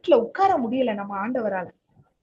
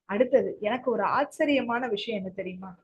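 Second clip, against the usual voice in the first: normal talking voice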